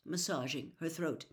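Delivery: usual speaking voice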